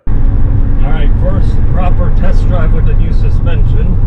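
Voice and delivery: loud, talking over road noise. Real, not acted. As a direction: project over the engine. Talking over engine noise while driving.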